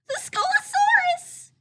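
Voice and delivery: very high, loud frightened yelp; high scared piglet yelp; yelp of fright. Acted. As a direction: shriek the name in panic. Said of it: "yelped Piglet".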